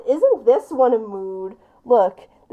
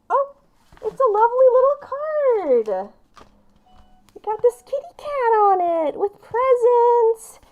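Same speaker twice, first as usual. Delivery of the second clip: cooing over card; 10 semitones higher than usual. Real, not acted. Admiring the card's kitty picture.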